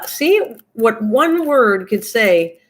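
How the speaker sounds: slow, deliberate, each word weighed; slow, pointed moral. Real, not acted. Vlogger telling viewers how one word changes your thoughts, slow and deliberate. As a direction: drive the lesson home slowly.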